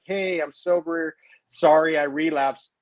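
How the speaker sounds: sheepish confession. Retold or imitated. Calling ex-wife with news.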